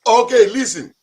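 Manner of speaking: hammed-up African accent. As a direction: put on a bossy African husband voice. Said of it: "That's such a wonderful accent you have".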